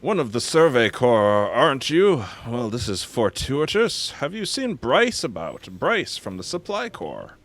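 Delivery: NPC voice. Acted. Voices game character dialogue.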